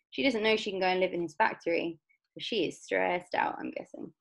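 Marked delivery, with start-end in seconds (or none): none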